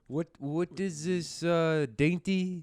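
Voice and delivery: put-on slow, halting European-accented English; mock European accent. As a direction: copy a European puzzling over English. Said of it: "That's how they talk".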